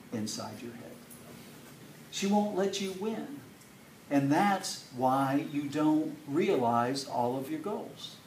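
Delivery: normal talking voice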